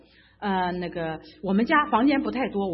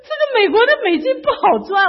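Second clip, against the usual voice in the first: high, loud exasperated grumble; loud complaint; 11 semitones higher, 9 dB louder than usual. Retold or imitated. Complaining that American dollars are hard to earn, loud exasperated grumble. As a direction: vent it loudly, comically fed up.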